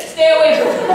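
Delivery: ordinary delivery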